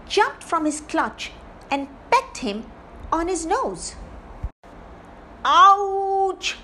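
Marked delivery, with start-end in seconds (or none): 5.4-6.6 loud, high yelp of pain; shouting while wounded. Acted. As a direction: cry out as if just pecked on the nose. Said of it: "Sylvester shouted in pain".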